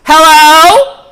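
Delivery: loud, high-pitched call into a dark room; mock loud call. Imitating the girl barging into the bedroom, loud high call. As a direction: call out sharply, hammed up. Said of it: "she goes".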